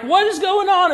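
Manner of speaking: high frantic cry of alarm; panicked sailors. Retold or imitated. Sailors in a storm cry out in high panic.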